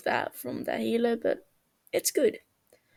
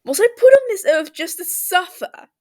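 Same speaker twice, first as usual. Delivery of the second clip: loud, high, hammed-up wail; 8 semitones higher, 10 dB louder than usual. Tommy wailing to Techno from brain freeze, hammed-up. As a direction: wail it like the world is ending. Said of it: "Tommy wails".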